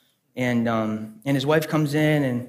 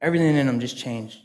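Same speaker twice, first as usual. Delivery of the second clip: ordinary talk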